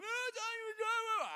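high-pitched imitation of a child's wail; hammed-up garbled toddler cry; mock crying. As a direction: wail like a worked-up four-year-old trying to talk. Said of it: "he tries to talk while he cries".

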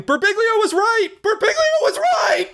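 mock frantic yell. Retelling yelling in agony at the urologist, high wailing shout. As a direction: wail it out like a man in agony. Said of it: "I just started yelling".

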